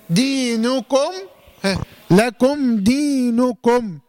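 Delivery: slow chanted لكم دينكم, each m closed plainly before the next sound; clear unmerged meem. Shown as a demonstration. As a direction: recite لكم دينكم with long "dee" and crisp "kum". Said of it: "يبقى حكمها الإظهار".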